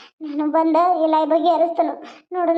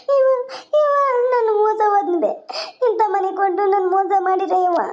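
acted tearful wail to mother; weeping daughter's lament; crying; 2 semitones higher than usual. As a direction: wail out the betrayal, falling away at each end. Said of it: "ಯಾಕ್ ಅಳಕತ್ತಿ ನನ್ ಬಂಗಾರ".

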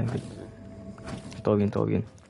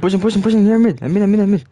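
loud, higher urgent call; shouting; 6 semitones higher, 6 dB louder, faster than usual. Real, not acted. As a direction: yell your position to the team. Calling position during fight.

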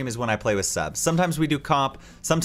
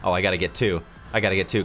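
usual speaking voice

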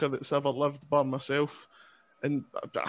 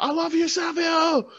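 fan shout; 12 semitones higher than usual. Pretend. Interrupting a seminar, yelling adoration at Savio Vega. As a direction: blurt it out like a starstruck fan. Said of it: "interrupt the entire seminar and just be like".

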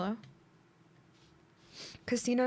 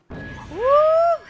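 excited whoop; 11 dB louder than usual. Real, not acted. Finds an item.

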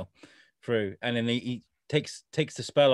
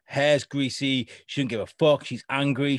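ordinary delivery

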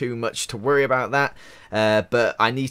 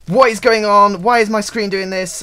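much higher, louder confused outcry; alarmed shout; 10 semitones higher, 9 dB louder than usual. Real, not acted. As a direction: yell at the glitching screen. Screen glitching, house burning.

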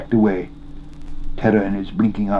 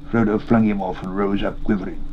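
ordinary delivery

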